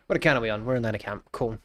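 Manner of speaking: ordinary talk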